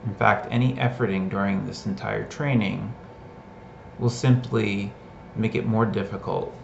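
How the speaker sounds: measured meditation guide, long pauses between phrases; guided meditation. Real, not acted. Teacher leading listeners into a body scan, guided meditation. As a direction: guide them into listening, leaving space after each phrase.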